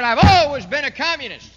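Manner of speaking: loud, proud shouted admission; defiant declaration. Retold or imitated. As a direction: boom it out like a dictator confessing proudly. Castro quoted admitting communism.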